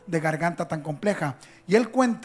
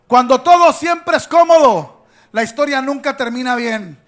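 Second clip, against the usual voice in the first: loud, driving sermon delivery; full-force preaching; 8 dB louder than usual. Acted. Preacher exhorting the church about trials, loud and driving. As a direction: preach it out to the congregation.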